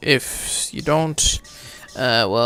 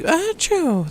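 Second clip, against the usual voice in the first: imitated sneeze. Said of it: "Teddy's na-, nasal explosion".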